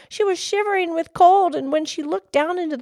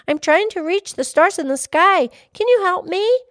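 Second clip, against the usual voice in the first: put-on higher, louder little girl; 5 semitones higher, 6 dB louder than usual. A narrator's reading. Little girl asking the fish for help, higher voice. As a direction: ask eagerly in a raised child's voice.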